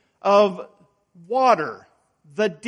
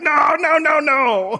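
loud, much higher, frantic no's; mock-panicked shouted no's; panicked denial; 7 semitones higher, 4 dB louder, slower than usual. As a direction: frantically try to undo the sent text. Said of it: "It's like".

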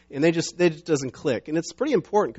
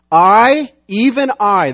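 "I" punched out, louder and higher; emphatic; 6 semitones higher, 12 dB louder, much slower than usual. Acted. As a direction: stress both I's, loud and high. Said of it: "this is very emphatic".

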